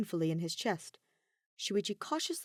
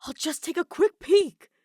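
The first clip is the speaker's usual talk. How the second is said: promising; 11 semitones higher, 9 dB louder than usual. Acted. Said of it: "Shuichi promised".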